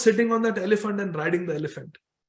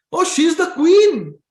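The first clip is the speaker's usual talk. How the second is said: excited boast; 7 semitones higher, 7 dB louder than usual. Acted. Boy tells townspeople who his Didi is, excited. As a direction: announce it loudly like a proud little boy.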